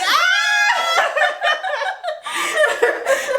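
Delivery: very high, drawn-out mock bawling; hammed-up wailing that tips into laughter; loud fake wail. Lover crying so they can hear, mock wail. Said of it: "I'm just gonna cry louder".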